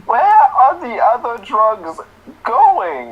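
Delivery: very high, slow, drawn-out voice; silly squeaky Batman voice. Pretend. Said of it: "that's your voice?".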